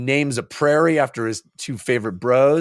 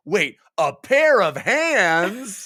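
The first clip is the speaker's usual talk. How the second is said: higher, drawn-out incredulous double take; mock sudden realization; 5 semitones higher, much slower than usual. Troll realizing what grasped him, hammed-up surprise. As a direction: stretch out PAIR OF HANDS in comic disbelief.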